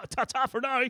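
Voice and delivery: high, chirpy posh English ta-ta; posh English sign-off. Retold or imitated. Ending the call to Rupert with a high posh English goodbye. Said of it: "When did I get a posh prick".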